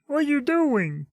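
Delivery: high, slow put-on character voice; Alex. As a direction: do a drawn-out, high cartoonish voice. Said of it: "What's the voice we gave him?".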